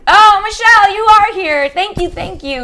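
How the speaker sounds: high, loud delighted hello; light up greeting a friend who just arrived; gushing welcome to Coach Michelle; excited greeting. Real, not acted. Greeting viewer arriving live.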